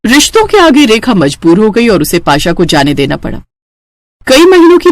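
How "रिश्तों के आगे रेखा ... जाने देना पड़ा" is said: ordinary delivery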